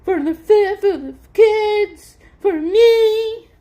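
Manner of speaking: crying woman insisting on what he owes. Real, not acted. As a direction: sob it out, upset and pleading.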